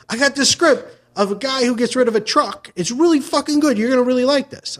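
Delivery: high, excited script hustle; eager pitch. Acted. Host hawking a script about a truck, eager pitch. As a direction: sell it with mock confidence. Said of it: "Or you're like".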